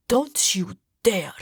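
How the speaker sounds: slow, hissed warning; threatening whisper. Acted. Husband answering accusation.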